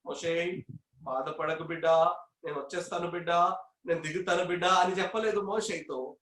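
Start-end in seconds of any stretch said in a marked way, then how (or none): 0.1-4.7 much softer, lower, gentle reassurance; tender comforting god. Retold or imitated. God comforting Moses, soft and low. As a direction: soothe a weeping child. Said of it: "దేవుడు ఏమని చెప్పి ఉంటారు".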